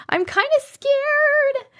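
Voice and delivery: high, drawn-out mock fright; playfully scared. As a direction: stretch it into a high, slow little whine.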